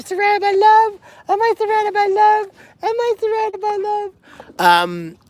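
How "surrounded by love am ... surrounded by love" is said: much higher, sing-song cooing. Real, not acted. Telling the pigs they are loved, in high baby talk. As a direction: coo lovingly to the pigs.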